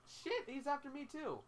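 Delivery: high, loud cry of panic; alarmed yelp. Real, not acted. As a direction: blurt it out as the monster turns on you. Monster suddenly targets the player.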